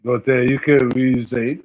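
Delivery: usual speaking voice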